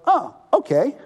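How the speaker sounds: a voiced God, generous and building; God lavishing blessings. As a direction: hand out gifts with rising delight. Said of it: "God goes".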